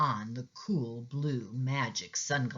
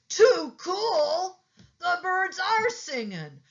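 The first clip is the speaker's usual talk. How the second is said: excited cat. A narrator's reading. Pete seeing the world without sunglasses, high and delighted. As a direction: exclaim at the world with pure joy.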